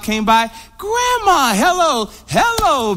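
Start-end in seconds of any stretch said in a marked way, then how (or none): 0.8-2.1 put-on high, sing-out child greeting; excited grandchild. Grandchild arriving at grandma's house, high-pitched excited greeting. 2.3-3.0 high, cooing greeting; delighted grandmother. Retold or imitated. Grandmother welcomes the visiting grandchild, high fond greeting.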